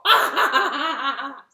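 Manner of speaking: big loud elephant guffaw; loud mocking laugh. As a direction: laugh loud at the lazy spider.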